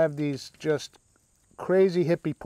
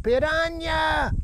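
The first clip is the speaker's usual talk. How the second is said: high, drawn-out cry of panic; hammed-up monster-movie alarm, stretched out high; mock horror-movie scream; 12 semitones higher, much slower than usual. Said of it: "some kind of horror movie".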